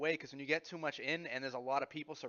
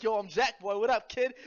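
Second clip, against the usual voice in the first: put-on hyped kid voice; 8 semitones higher, 10 dB louder than usual. Imitating kid hyped in backseat.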